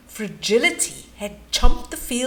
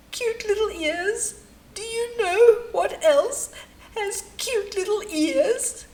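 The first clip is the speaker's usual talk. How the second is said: offended whine; 6 semitones higher than usual. Acted. Hippo taking offence at the compliment, whining high. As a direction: sound wounded and indignant.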